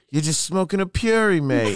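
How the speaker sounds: high-pitched imitation British accent. British guy questioning an American smoker, imitated British accent. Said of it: "you got a pretty good accent there".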